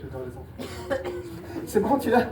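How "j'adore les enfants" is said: ironic aside. Real, not acted. Aparté ironique au public.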